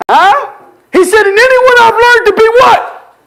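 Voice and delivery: high-pitched, driving pulpit cry; full-force preaching. Real, not acted. Preacher pressing the verse on every condition, impassioned preaching.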